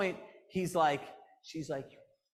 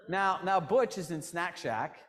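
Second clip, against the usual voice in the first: ordinary talk, nothing marked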